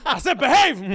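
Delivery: high, explosive mock scolding; imitating dad's shout. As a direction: bark it like a dad losing patience. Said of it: "that level where he has to shout".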